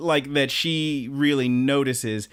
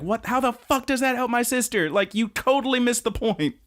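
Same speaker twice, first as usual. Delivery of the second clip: much higher, exasperated outburst; freaking out; 10 semitones higher than usual. Retold or imitated. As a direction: blow up at him in disbelief. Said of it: "Konoha freaks out".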